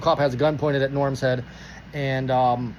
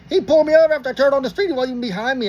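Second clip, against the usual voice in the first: fast, high-pitched complaint; heated protest; 8 semitones higher, faster than usual. Retold or imitated. Driver tells officer about the stop, heated protest. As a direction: act out pleading your case in a rush.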